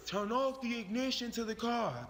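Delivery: officer's order pitched well above the narration; police officer command. Acted. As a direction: call out a firm police instruction. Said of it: "one of the officers said".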